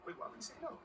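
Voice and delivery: high, indignant question; hurt surprise at the refusal; surprised. Acted. MP taken aback by Boz.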